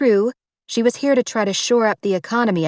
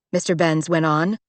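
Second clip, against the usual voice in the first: usual speaking voice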